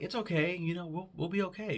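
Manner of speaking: lightly voiced toy saying it'll be fine; a hint of a soothing toy; reassuring toy voice. Voicing a toy's reassurance.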